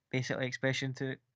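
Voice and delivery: normal talking voice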